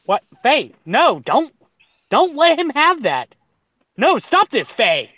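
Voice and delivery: loud, high, laughing plea; frantic urging. Retold or imitated. Streamer reliving urging Faye to speak up, loud and frantic. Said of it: "And I'm just like".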